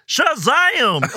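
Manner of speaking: high-pitched Southern goofball exclamation; Gomer. Retold or imitated. Host agreeing the actor channels Gomer, mock Southern exclamation. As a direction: imitate a naive Southern yokel, pitched high. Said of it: "he channeled his inner Gomer".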